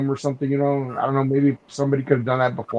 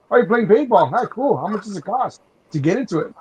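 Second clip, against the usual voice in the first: higher-pitched, curious cop voice; friendly cop imitation; 6 semitones higher than usual. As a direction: play a cop who's pleasantly intrigued.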